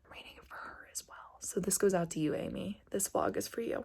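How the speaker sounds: breathy secret whisper to her cousin. Real, not acted. YouTuber whispers a dedication to her cousin Amy, whispered.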